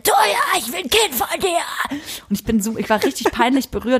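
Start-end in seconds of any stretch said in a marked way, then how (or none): 0.0-2.0 imitating a fan calling out, much higher; a playful mock shout from afar. Fan shouting at her in the underpass, high mock call.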